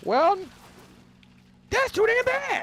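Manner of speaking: high, loud shouted retort; meme voice. Acted. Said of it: "best prime example".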